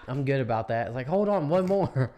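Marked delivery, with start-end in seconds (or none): 1.1-2.1 slow, high, loud stalling plea; slow pleading. Retold or imitated. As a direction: drag it out pleadingly. Imitating herself stalling.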